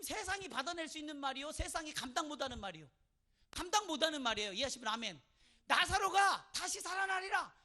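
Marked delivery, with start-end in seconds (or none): 5.7-7.5 loud, high, slow declaration; booming proclamation. Acted. Jesus promising Lazarus will rise, proclaimed loudly and slowly. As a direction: proclaim it at full force.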